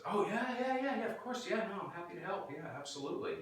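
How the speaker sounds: high, quick nervous yeses; flustered agreeing. Retold or imitated. Caught neighbor kid eagerly agreeing to help, high and nervous. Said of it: "Steven goes".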